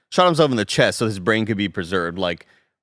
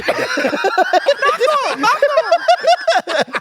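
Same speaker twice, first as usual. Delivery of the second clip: high loud laugh; shrieking laugh; 5 dB louder than usual. Real, not acted. Friends laughing at the story, shrieking laugh. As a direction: let out a screeching laugh.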